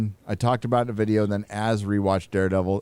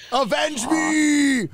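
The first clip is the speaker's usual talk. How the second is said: huge shouted dying plea; overacted death scene; much slower than usual. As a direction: ham up a dying pilot's last cry. Top Gun Goose death parody.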